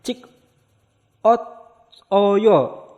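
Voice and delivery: cik ot oyo said split apart, syllable by syllable; separate syllables. Shown as a demonstration. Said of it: "maka jadinya cikosoyo".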